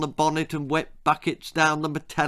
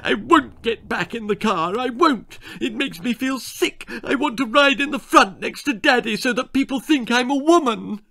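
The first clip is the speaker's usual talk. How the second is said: high, loud, bratty whine; temper tantrum; 11 semitones higher, 4 dB louder than usual. Acted. Daughter refusing the back seat, high tantrum. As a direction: stamp your foot like a brat. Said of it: "She could do temper tantrum".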